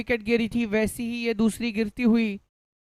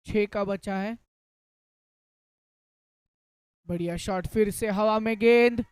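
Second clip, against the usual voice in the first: hindi cricket commentary. Real, not acted. Live cricket play-by-play.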